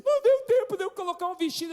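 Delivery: high, loud mock child's wail; upset little daughter. Daughter caught without her dress, imitated as upset. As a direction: imitate a flustered girl in a squeaky fret. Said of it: "Aí ela fica nervosa".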